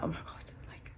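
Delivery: stunned whisper. Retold or imitated. Stunned by stranger's kindness.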